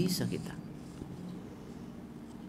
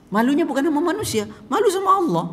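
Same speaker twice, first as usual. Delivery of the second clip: fervent emphasis; 11 semitones higher, 4 dB louder, faster than usual. Acted. Preacher stresses shame before Allah.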